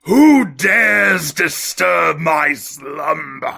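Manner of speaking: slow booming ancient statue voice; grinding stone statue. Acted. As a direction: rumble it out like a waking stone idol. Guest freed from glass case.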